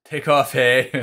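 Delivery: mock Canadian hoser voice, slow and loud; Canadian accent. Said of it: "work on my Canadian accent".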